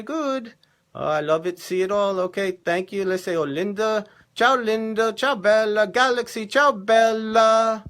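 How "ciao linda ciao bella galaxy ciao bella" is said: loud, drawn-out ciao to fans; calling out greetings. Real, not acted. Host greeting Linda and other viewers, calling out. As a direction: hail each one, stretching the last word long.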